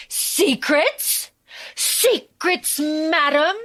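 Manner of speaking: slow, high-pitched theatrical indignation; hammed-up outrage without real shouting; mock outraged yelling. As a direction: imitate an incredulous scolding, drawing out each word. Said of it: "Cecil yells at her".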